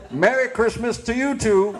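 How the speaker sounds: put-on high, hearty Southern old-timer voice; old campground proprietor. Proprietor mishearing Hare Krishna, high-pitched hearty Southern voice. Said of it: "He said".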